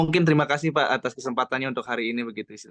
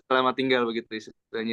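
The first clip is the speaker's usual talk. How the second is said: ordinary talk, nothing marked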